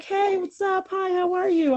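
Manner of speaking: imitating friendly missionaries; cheery missionaries. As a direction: play the eager elders greeting her. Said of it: "they were like".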